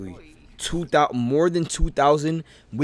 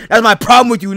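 escalating anger; 5 semitones higher, 10 dB louder, faster than usual. Acted. Argument escalates.

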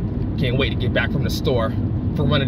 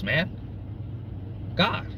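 ordinary delivery

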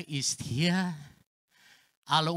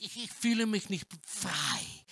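ordinary delivery

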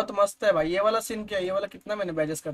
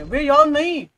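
startled yelp; 8 semitones higher, 9 dB louder, slower than usual. Real, not acted. Reacting to game mid-match.

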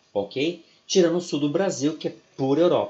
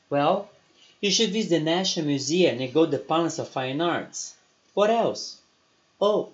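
reading a dialogue; 4 semitones higher than usual. Acted. Teacher reads the lesson dialogue to students. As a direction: act out both sides of the dialogue clearly.

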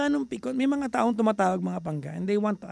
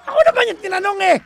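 high, loud comic yelling; mock angry shout; 11 semitones higher, 9 dB louder, faster than usual. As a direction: yell in put-on outrage at the listeners. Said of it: "Ako yung galit eh". (pabirong galit na sigaw)

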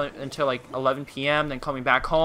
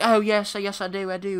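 higher-pitched imitation British accent; mock British accent; 6 semitones higher than usual. Imitating Corden's team in huddle, mock British accent. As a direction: agree eagerly as a parody Brit. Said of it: "they're all from Britain".

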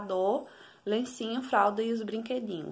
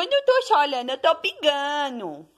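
put-on high whiny baby voice with a crying edge; speaking as the baby; 7 semitones higher, 5 dB louder than usual. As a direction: voice the baby's protest, high and teary.

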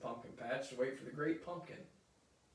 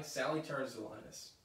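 plain reading voice

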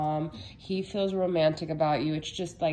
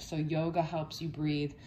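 ordinary delivery

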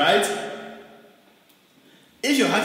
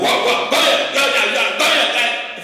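loud, high yapping like an attack dog; mock barking; 6 semitones higher, 7 dB louder than usual. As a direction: bark wildly in rapid bursts. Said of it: "The man will be back".

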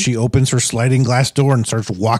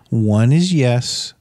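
one slow word at a time, spelling out the code; drawn-out spirit code; much slower than usual. Pretend. To an evil spirit, setting a slow code: spelled out, drawn out. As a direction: stretch it out so the spirit counts along.

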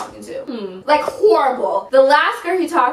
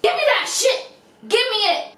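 high, sudden mock squawk; hammed-up bossy squeal; mock angry demand; 10 semitones higher than usual. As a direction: demand it sharply, pitched way up. Acted girlfriend snatching the phone.

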